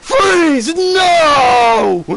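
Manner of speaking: high, loud desperate cry to a falling man; mock anguished yell; anguished shout. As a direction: scream his name in despair. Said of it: "he's like".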